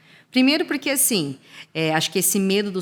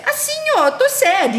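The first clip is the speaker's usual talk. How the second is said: very high, louder kid voice acting sad; imitating a little girl; 4 dB louder, slower than usual. Granddaughter acting out sad to grandma, high kid voice. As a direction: show it like a small girl demonstrating. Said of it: "ela fala pra minha mãe assim".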